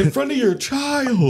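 high, slow, drawn-out mock outrage. Friend parodying a fight before a child, mocking. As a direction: draw it out high for comic effect.

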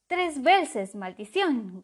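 loud, high cursing crow; shout. A narrator's reading. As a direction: crow curses his luck. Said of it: "gritó el cuervo".

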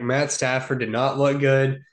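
normal talking voice

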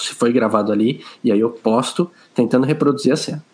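ordinary delivery

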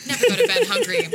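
high, loud slogan shout. Pretend. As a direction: belt the slogan like a jingle. Quoted slogan in ad.

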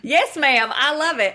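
put-on a playful, awful try at a Southern accent; bad Southern accent. Host echoes guest's Southern "yes ma'am" in a bad Southern accent. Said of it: "I don't know what that accent was. Awful".

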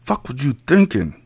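angry words forced through clenched teeth. A narrator's reading. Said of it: "grilled through clenched teeth".

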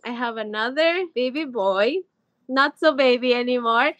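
usual speaking voice

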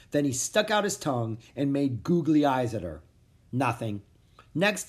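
ordinary delivery

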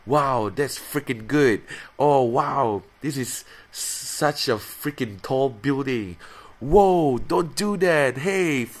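high, loud, slow mock exclamations; hammed-up clean cursing. Covering his swearing with silly words, hammed up and loud. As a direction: play up the fake swears for laughs. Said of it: "I will cover it up".

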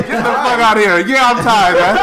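loud, high, laughing exclamation; giddy disbelief; laughing yell. Real, not acted. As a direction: crack up while shouting it. Laughing at tie for number one.

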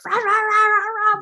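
high, loud wordless rant; frustrated mock yelling. Wife re-enacting her yelling on the stairs, frustrated mock yell. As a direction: let out a stream of high "rah rah" outbursts. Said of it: "I was, like, yelling".

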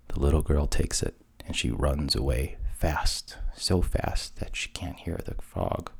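ordinary delivery, nothing marked

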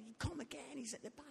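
ordinary talk

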